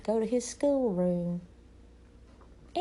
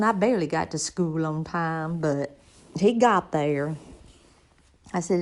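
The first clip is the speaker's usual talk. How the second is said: ordinary talk, nothing marked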